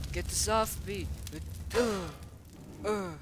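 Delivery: loud, high grunts of mock panic; pretend struggling. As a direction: play at fighting something off. Figure struggles in play fight.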